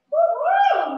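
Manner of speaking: high, loud woo-hoo cheer; excited whoop. Real, not acted. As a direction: celebrate with a big woo-hoo. Cheering at end of set.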